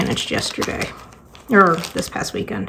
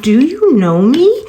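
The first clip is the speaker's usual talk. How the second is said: louder, higher, slow do-you-know-me; incredulous; 6 semitones higher, 5 dB louder, slower than usual. Retold or imitated. Sarcastic retort to her ex.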